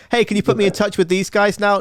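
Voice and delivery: high, put-on pleading voice; playful high-voiced ask; mock eager request. Jokily voices a future request.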